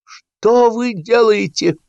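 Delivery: very high, drawn-out yell; outraged cry. A narrator's reading. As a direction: cry out in slow, scandalised disbelief. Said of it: "закричал Сапфиров".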